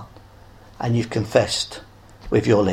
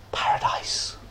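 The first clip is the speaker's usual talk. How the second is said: soft reverent whisper on paradise; 9 dB quieter than usual. Acted. Whispered close of the promise.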